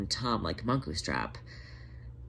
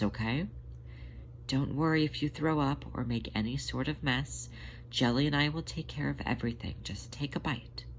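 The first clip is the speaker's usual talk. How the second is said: quiet, gentle coaxing, dropping to a whisper; hushed, tender nurse voice; soft reassurance; 3 semitones higher, 6 dB quieter than usual. A narrator's reading. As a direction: soothe him into taking a bite. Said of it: "smiled softly in reassurance".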